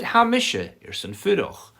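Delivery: "misha" thrown high and loud; emphatic contrast. Shown as a demonstration. Teacher plays the partner who wants to stay, emphatic contrast. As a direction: counter firmly, lifting "Ha misha" well up. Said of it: "That's how they emphasise".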